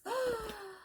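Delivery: high, drawn-out gasp of admiration; awed gasp. Pretend. Telling viewers about her friend's gorgeous version, admiring gasp. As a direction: gasp in mock astonishment. Awe at the other stitcher's version.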